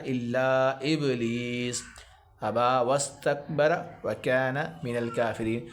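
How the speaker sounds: measured, melodic tajweed reading; Quran recitation. Shown as a demonstration. Teacher reciting the verse for students. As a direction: chant it as a recitation.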